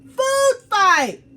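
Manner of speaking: high, loud shout of food fight; yelling. Acted. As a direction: yell it across the lunchroom. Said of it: "Somebody yelled".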